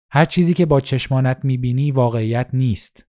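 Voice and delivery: usual speaking voice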